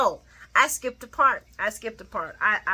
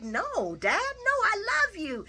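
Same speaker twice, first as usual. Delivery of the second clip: pleading Michael; 13 semitones higher than usual. Acted.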